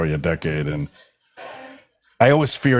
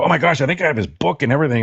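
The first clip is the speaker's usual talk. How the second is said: excited; 8 semitones higher, faster than usual. Retold or imitated. Retold excitement, fast high.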